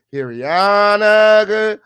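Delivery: slow, loud stretched name; drawn-out callout. Real, not acted.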